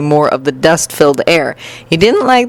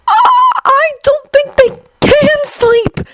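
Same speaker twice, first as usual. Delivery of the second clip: very high, slow panicked wail; frightened cry; slower than usual. A narrator's reading. Student leaping from the monster's grasp, crying out in fright. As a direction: squeal it out in alarm. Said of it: "Midoriya cried, leaping back".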